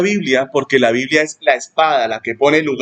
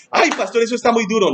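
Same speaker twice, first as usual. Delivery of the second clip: higher, plaintive mock complaint; whiny protest; 7 semitones higher than usual. Member complaining to the pastor, plaintive and higher. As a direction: whine it like a hurt believer.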